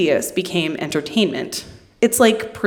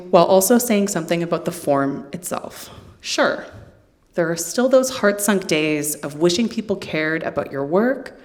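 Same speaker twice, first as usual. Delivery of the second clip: normal talking voice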